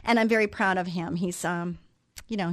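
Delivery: ordinary talk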